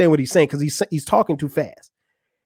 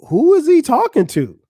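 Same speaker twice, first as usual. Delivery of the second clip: high-pitched, incredulous question; bewildered reaction; 10 semitones higher, 4 dB louder than usual. Retold or imitated. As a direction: replay your baffled reaction, pitched way up. Acts out own bewildered reaction.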